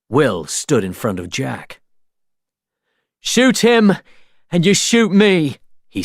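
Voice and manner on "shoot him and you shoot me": high, loud challenge; defiant shout. A narrator's reading. Will shielding Jack from the soldiers, loud defiance. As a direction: stand firm and dare them.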